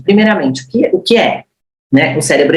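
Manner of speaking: usual speaking voice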